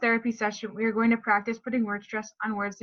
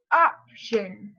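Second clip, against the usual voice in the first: option with a louder OP; stressed first syllable; 4 dB louder than usual. Shown as a demonstration. Therapist modeling stress in example words, stressed first syllable. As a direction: lean on the OP of option. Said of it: "for words like".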